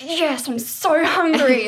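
high, loud and drawn-out; excited relief. Retold or imitated. Hungry patient told surgery is go, slow excited cry. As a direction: stretch it out, starving and thrilled.

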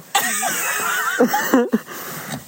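high howling laugh; shrieking laugh. Real, not acted. Laughing hard at friend.

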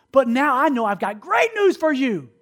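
high, slow, building proclamation; excited announcement. Retold or imitated. Preacher promising the stranger great news, excited. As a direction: stretch it out like big news.

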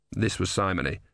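ordinary delivery

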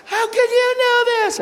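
very high shriek of disbelief; imitating a screaming waitress; freaking out. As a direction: squeal it like she can't believe it. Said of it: "She screams, starts freaking out".

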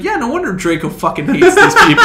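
loud high exasperated retelling; exasperated outburst. Retold or imitated. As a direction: vent at the screen in disbelief. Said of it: "I was like".

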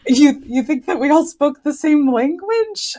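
mock-incredulous question, pitch climbing high; incredulous retort. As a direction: act out disbelief at a silly assumption. Acting out her reaction to naive question.

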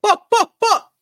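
high, loud laughing shout; excited shout-out. Real, not acted. Guest yelling a shout-out to Sweden, laughing. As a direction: yell it out through laughter.

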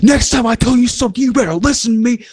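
high, scolding imitation of an angry woman; imitating the angry woman scolding the kids; mock scolding Karen. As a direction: mimic her wagging her finger in their faces. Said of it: "She's like".

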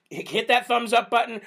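ordinary talk

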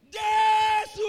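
long, high full-force cry; shout. Acted. Preacher mimics praying on a mountain, shouting. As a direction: holler the name up a mountain. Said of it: "you shout".

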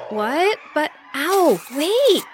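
high-pitched alarmed protest, climbing; crying out. A narrator's reading. Dragonfly rushed by angry monkeys, crying out. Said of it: "she cried".

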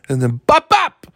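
bop bop jumps high and loud; sudden yell. Shown as a demonstration. Host snaps viewers awake with a sudden high yell. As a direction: jolt everyone awake. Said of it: "wakes everybody up".

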